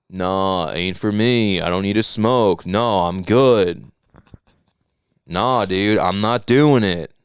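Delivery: low, slow, mumbled Kawhi drawl; lightly voiced laid-back Kawhi; Kawhi Leonard impression. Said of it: "I'm doing Paul George doing a Kawhi, Kawhi Leonard impression".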